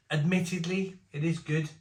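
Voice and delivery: ordinary delivery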